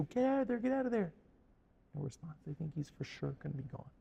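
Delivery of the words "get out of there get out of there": mock radio scream. Army calling a deafened soldier by radio, mock screaming. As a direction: imitate screaming into a radio, held back. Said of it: "They're screaming in the radio".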